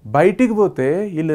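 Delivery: usual speaking voice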